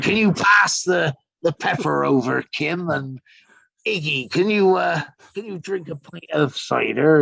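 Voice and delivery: high, hammed-up Somerset accent; dinner-party guest asking for cider in a broad Somerset accent. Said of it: "[name] speaking in a Somerset accent, going".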